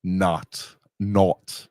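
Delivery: ordinary delivery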